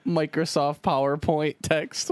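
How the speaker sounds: ordinary delivery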